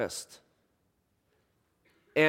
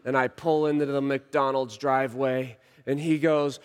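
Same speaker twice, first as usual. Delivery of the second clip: ordinary delivery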